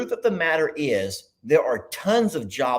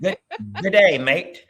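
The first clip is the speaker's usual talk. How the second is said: put-on trying the Australian accent; 3 semitones higher, 4 dB louder than usual. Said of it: "we're gonna try to repeat it".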